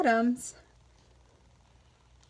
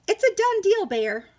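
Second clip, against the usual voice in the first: chirpy Hare; 9 semitones higher, 5 dB louder than usual. Acted. Hare sealing deal.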